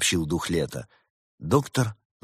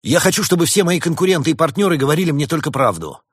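high, loud blurted wish; blurting; 10 semitones higher, 8 dB louder than usual. A narrator's reading. Flustered badger states his wish to the spirits, blurting. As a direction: blurt it out in raised pitch, obediently. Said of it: "он послушно выпалил".

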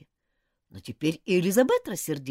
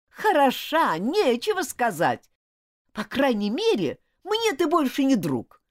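losing patience; 11 semitones higher, 8 dB louder than usual. Acted. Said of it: "Он тоже стал терять терпение".

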